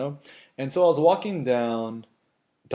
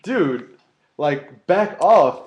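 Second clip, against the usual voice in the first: louder, slow back off; retold warning; 5 semitones higher, 7 dB louder, much slower than usual. Retold warning to the grabbing guy, louder and slow. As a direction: push him away with the words. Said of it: "And I'm like".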